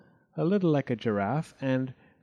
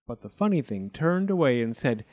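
plain reading voice

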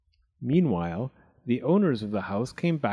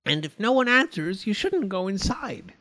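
high, chiding voice; scolding mother bear; 7 semitones higher than usual. A narrator's reading. Mummy Bear adding to the telling-off, chiding. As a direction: chide in a higher voice. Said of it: "added Mummy Bear".

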